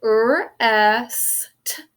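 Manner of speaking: "R, S, T" as rrr, eh-sss, t; phonics-teacher "R, S, T", held then clipped t; single sounds. Shown as a demonstration. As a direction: sound out "R, S, T" one by one. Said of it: "four sounds in that first syllable".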